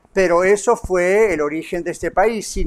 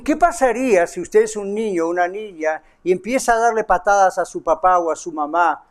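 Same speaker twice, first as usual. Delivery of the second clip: ordinary talk, nothing marked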